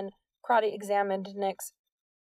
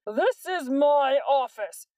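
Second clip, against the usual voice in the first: loud, slow, high furious shout; 5 semitones higher, 6 dB louder, slower than usual. A narrator's reading. Principal shouting at officer in his office, furious. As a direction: roar it at the officer. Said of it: "McGuckin shouted".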